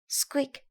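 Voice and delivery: a sharp high squeak; high squeak sound. Acted. Narrator voicing the shoe, high squeak.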